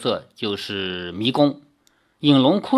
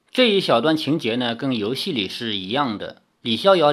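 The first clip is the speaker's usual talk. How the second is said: usual speaking voice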